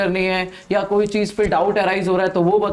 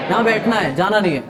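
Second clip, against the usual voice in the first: ordinary talk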